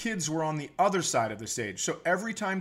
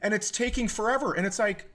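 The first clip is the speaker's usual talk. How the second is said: ordinary talk, nothing marked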